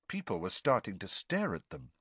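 usual speaking voice

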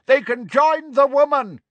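high, loud, hostile shout. Acted. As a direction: shout the threat over a crowd. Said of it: "a dun-coloured centaur shouted".

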